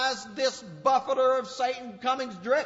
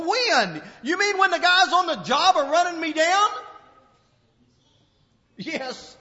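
ordinary delivery

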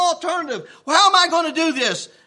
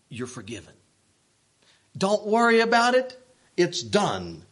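gentle forgiveness; 6 semitones lower than usual. Retold or imitated. Forgiving the debtor.

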